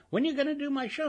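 high, eager nagging question; pleading. Retold or imitated. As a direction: badger her playfully. Acted quote of herself pressing.

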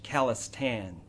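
usual speaking voice

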